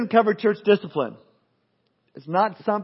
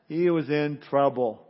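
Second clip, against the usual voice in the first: ordinary talk, nothing marked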